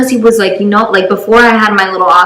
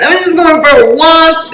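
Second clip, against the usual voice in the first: high, mildly whiny mock crying; acted teary excuse; imitated tearful plea; 7 semitones higher than usual. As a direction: plead in a higher voice, as if in tears. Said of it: "Like, I'm in tears".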